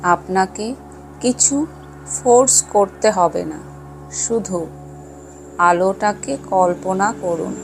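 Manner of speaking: measured, soothing delivery, lingering on words; guided meditation. Acted. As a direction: guide listeners into calm, phrase by unhurried phrase. Leads a guided chakra meditation.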